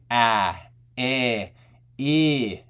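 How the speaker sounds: ah, eh, e each shown as a bright vowel; demonstrated bright ah, eh and e vowels. Shown as a demonstration. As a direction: sound out ah, eh, e brightly, one by one. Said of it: "vowels that promote high frequencies".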